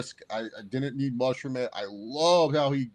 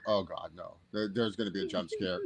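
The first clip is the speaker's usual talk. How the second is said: hushed dread; 7 semitones lower, 9 dB quieter than usual. Retold or imitated. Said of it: "and I'm like".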